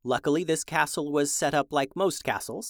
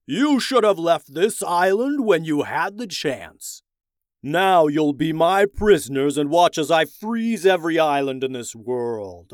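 gloating villain; 7 dB louder than usual. Acted. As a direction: threaten them like a cold tyrant king. Ice King villain threatening.

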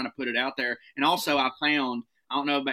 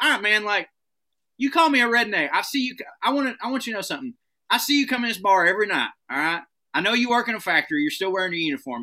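raised, fast and worked up; heated callout; 6 semitones higher than usual. Retold or imitated. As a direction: lay into the guy at the bar. Heated bar confrontation, retold.

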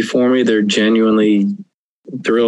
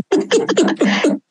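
high laugh. Real, not acted. Laughs at giving up.

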